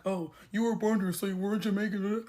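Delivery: mock commenter voice, altered timbre; lightly mocking quote of online critics; mimicking commenters. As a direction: imitate the objectors in a put-on voice. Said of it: "y'all be like".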